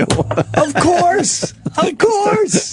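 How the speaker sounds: high, squealed agreement; incredulous high-pitched outburst; emphatic agreement. Real, not acted. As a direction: exclaim it way up high. Comic high-pitched agreement with co-host.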